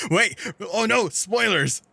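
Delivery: high, loud blurted panic; loud high-pitched dismay; alarmed outcry. Real, not acted. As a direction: yell out in playful alarm. Alarmed at own spoiler.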